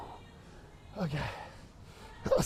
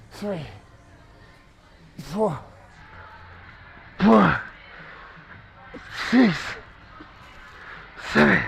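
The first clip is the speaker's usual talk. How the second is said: loud effortful rep count; strained counting; 6 semitones higher, 9 dB louder, slower than usual. Real, not acted. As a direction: force each number out. Counting reps under strain.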